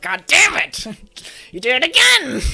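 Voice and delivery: loud, high exasperated yell; shouted frustration; angry outburst. Acted. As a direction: blow up at the coworker, half laughing. Acted coworker outburst, laughing.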